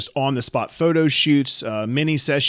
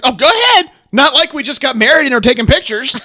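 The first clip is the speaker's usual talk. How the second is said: loud, high, sarcastic jab at strangers; mocking "go ahead", louder and higher; sarcastic yell; 10 semitones higher, 8 dB louder than usual. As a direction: throw the sarcasm at them at full volume. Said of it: "it's like".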